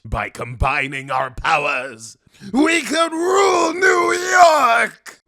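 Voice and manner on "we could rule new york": high, full-force drawn-out yell; megalomaniac villain shout. Acted. Villain proposing to rule New York together, crazed shouting. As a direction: roar it in crazed triumph. Goblin's climactic boast.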